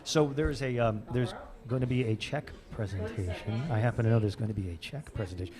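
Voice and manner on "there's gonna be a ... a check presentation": golf commentator. Acted. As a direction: murmur it like a golf broadcast. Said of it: "I'm gonna have to use the golf voice here".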